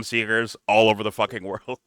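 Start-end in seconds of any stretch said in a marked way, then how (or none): none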